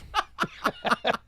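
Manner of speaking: high breathy laugh bursting out; laughing at the joke; burst of laughter. Real, not acted. Laughing at the Hormuz joke, high breathy laugh.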